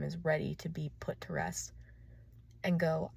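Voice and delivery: ordinary talk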